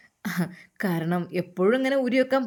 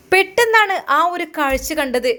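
dramatic reveal; 7 semitones higher, 6 dB louder than usual. A narrator's reading.

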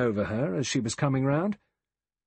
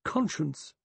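one hard, clear word, pitched up; stern, higher-pitched pronouncement; 6 semitones higher than usual. A narrator's reading. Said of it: "It fell hard and clear".

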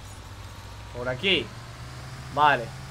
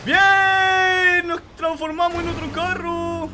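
loud, higher, slow cheer; gleeful cheer; 7 semitones higher, 4 dB louder, much slower than usual. Real, not acted. Gamer cheering as his bus becomes a car. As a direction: shout for joy at the change.

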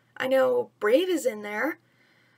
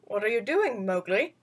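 a hint of a lower, smooth panther voice; lightly voiced panther; 3 semitones lower than usual. Narrator voicing Bagheera questioning Mowgli, lightly lower. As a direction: give Bagheera a calm low voice. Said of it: "Bagheera asked".

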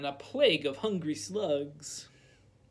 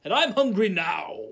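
a loud, higher roar; 4 semitones higher, 8 dB louder than usual. A narrator's reading. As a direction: bellow it back at him. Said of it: "roared the wizard".

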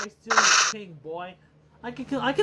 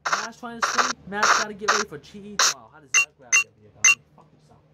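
ordinary talk

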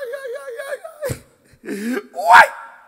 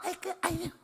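put-on high, cooing baby talk; mock lovestruck coo over a pet; doting puppy talk; 9 semitones higher, 4 dB quieter than usual. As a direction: gush like you are smitten with a puppy. Dog buyer cooing at puppy.

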